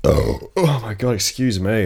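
usual speaking voice

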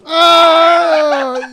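loud crying shout; pained shout. Real, not acted. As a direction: cry out through the sting. Pain after rip.

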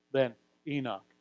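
usual speaking voice

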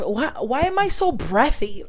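usual speaking voice

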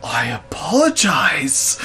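slow, breathless whispered sorry; whispered apology. Retold or imitated. Cornered boss whispering an apology to the yelling woman. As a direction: barely voice it, cowed. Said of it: "he's like".

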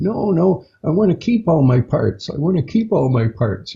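high-pitched mock yell of a scared child; retold yell. Child yelling at the being, a high mock protest. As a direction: cry out high, a child refusing. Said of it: "I started yelling".

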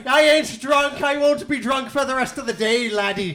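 hammed-up Irish drunk protesting; stage-Irish drunk talking back; mock Irish accent. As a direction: put on a defiant Irish accent. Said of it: "Oh, Irish".

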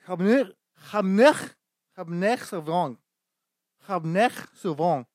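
"Cabernet Sauvignon" with a harsh throat-clearing rasp; mock French pronunciation. Pretentious customer ordering wine, "Cabernet Sauvignon" in hacked-up French. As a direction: choke out "Cabernet" with an exaggerated guttural French R. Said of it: "Don't put that".

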